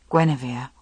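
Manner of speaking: plain reading voice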